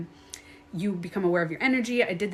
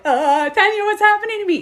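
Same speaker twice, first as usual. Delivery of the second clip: high, loud imitation of a freaked-out viewer; mock panicked viewer; 11 semitones higher, 8 dB louder than usual. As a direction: play a frightened follower asking for help. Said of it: "people are on my live like".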